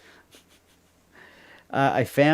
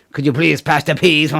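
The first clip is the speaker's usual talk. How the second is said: higher, louder, put-on French accent; mock French diner; 6 semitones higher, 8 dB louder than usual. Mocking French diners who ask for peas in a put-on French accent. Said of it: "They say".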